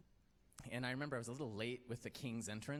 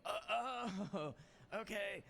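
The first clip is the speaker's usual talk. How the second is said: naive; 10 semitones higher, slower than usual. Retold or imitated. Naive realization in retold chat.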